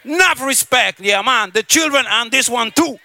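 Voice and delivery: usual speaking voice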